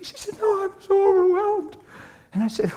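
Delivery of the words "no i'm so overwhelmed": very high, overcome wail; mock-tearful, overwhelmed squeal; imitated bawling. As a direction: wail it high, acting out a woman in tears. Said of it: "she's bawling".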